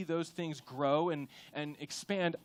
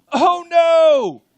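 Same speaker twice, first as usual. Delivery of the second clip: high, loud hammed-up cry of dismay; mock alarm; 11 semitones higher, 18 dB louder than usual. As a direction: wail it like a comic disaster. Mock alarm at soccer schedule.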